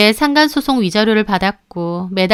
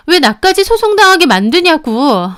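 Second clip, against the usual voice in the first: higher, indignant blame; angry complaint; 7 semitones higher, 5 dB louder than usual. Acted. Mistress blames the husband over the lawsuit, angry. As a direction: throw the blame at him. Quarrel in recorded phone call.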